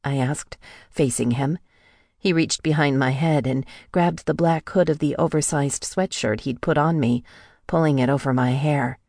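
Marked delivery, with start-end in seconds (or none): none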